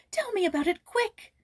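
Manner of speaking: put-on high and loud, impatient girlish eagerness; eager plea. A narrator's reading. Joyce urging Jules to tell quickly, eager. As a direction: beg him to hurry up. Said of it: "she cried with a beaming face".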